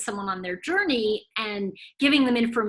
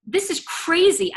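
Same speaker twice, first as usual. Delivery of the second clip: high, slow disbelief; retold client's incredulous protest; exasperated disbelief; 8 semitones higher, 6 dB louder than usual. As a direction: draw out CRAZY in protest. Upset client rejects the prediction.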